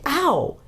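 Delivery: sharp high yelp of pain, as if stung; a quick startled yelp; sudden ow. A narrator's reading. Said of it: "made a noise like".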